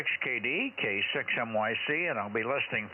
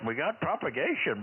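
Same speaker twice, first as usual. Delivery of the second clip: normal talking voice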